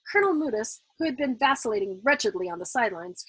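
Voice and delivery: plain reading voice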